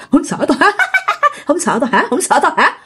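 taunting question broken by high laughter; cackling ghost. Retold or imitated. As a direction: laugh eerily while repeating the taunt. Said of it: "Cái kêu".

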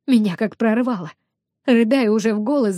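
ordinary delivery

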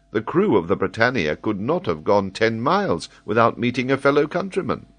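lightly voiced sailor pleading his case; a light, eager young captain; John Mangles, earnest young captain.

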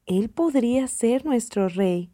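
ordinary delivery

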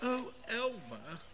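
very soft, high, thin voice; small high wail of a voice; distant plaintive call. A narrator's reading. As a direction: call out pleadingly from far off. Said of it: "called Wilbur".

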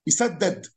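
يسدد voiced with standard Arabic vowels; classical verb vowels. Shown as a demonstration. Teacher shows the formal Arabic way to say يسدد. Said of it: "ما يقولش كده، يقول لك إيه".

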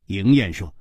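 ordinary delivery, nothing marked

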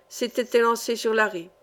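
plain reading voice

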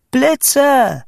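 high, loud command; shouting. A narrator's reading. Boy commanding the storm to come, shouting. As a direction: cry it out to the sky. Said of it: "schrie ich".

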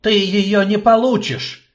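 loud, high furious outburst. Acted. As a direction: fling it out in fury. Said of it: "яростно бросил Горджелин".

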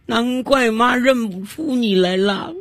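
high, slow, drawn-out lament; hammed-up weepy mother voice; tearful old mother. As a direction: wail over a long-lost child as a crocodile mum. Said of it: "老泪纵横地说道".

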